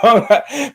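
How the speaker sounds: a quick high burst of laughter; high, sudden laugh. Real, not acted. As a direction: laugh out high at your own bit. Laughs at his own impression.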